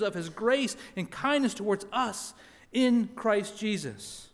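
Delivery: loud, earnest scripture reading. Acted. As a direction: proclaim the text to the congregation.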